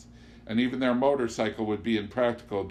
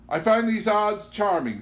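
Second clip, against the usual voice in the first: Amanda; 10 semitones higher, 6 dB louder than usual. Acted. Amanda delighted.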